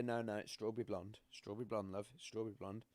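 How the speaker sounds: quiet, soft-pedalled motherly reassurance; mum's gentle correction. Retold or imitated. Mum gently correcting her son about his ginger hair, softly imitated. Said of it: "my mum would say".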